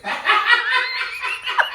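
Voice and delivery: real laugh, high and breathy. Real, not acted. The teller laughs at his own punchline, high. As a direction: crack up at the punchline.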